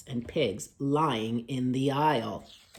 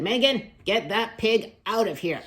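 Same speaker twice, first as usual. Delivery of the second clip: high, loud scolding order; a teacher telling her off in class; cross teacher; 8 semitones higher, 7 dB louder than usual. Acted. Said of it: "The teacher said".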